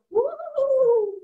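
high-pitched ghostly woo; Mama Ghost wail. Retold or imitated. Mama Ghost haunts the house, high wail. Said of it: "Mama Ghost would go".

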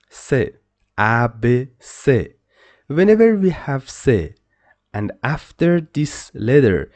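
usual speaking voice

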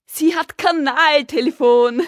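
high, drawn-out yell; excited shouting. A narrator's reading. Boy bursts out about the sewer telephone, excited yell. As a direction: shout it out, loud and long.

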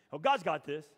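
loud, mocking replay of an empty comfort; mock dismissive echo. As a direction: sneer the cliché back at them. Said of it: "that's not what you want to hear".

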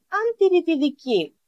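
usual speaking voice

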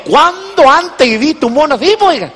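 high, wide-eyed wonder; amazed grandson. Retold or imitated. A grandson marvels at King Kong, high and amazed. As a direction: voice the boy's amazement at the huge monkey.

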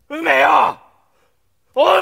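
loud, high cry of her name; desperate shout. Acted. Man shouting for his dead lover Ume, desperate. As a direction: call out to her as if to wake her.

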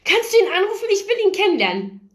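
very high, eager fangirl voice; excited begging. A narrator's reading. Paige begs to meet the guitarist, squeaky excitement. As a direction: beg in a high, excited rush.

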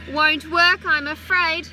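usual speaking voice

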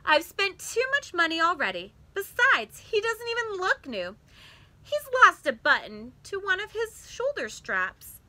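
firm mother turning down the bear; a touch of a scolding mother; mother explaining. A narrator's reading. Mother voiced in story.

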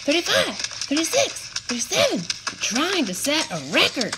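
high, quick counting voice; counting under breath. A narrator's reading. Meatball counting jumps.

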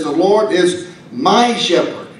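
"my" hit hard, the rest plain; stress on my. Shown as a demonstration. Preacher to congregation, pressing "my" firmly. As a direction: lean into the word "my". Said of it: "he has a personal relationship".

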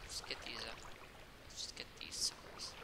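soft whispered aside. Real, not acted. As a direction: whisper it to yourself. Muttering while mining.

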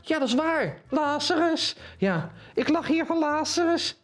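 a cheeky little puppet piping up; high puppet voice. Acted. Puppet Henk answers the presenter in a high voice.

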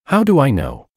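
understated sarcastic echo; mocking. A narrator's reading. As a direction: toss the question back with mild sarcasm. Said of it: "I laughed mockingly".

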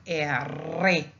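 erre with a long trilled rr; double R. Shown as a demonstration. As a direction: trill through the middle of erre. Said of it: "if you see a double, you pronounce it".

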